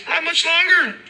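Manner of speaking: very high squeaky officer; mock officer. Officer Yi answering the man, squeaky mock voice. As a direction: squeak it out like a cartoon cop.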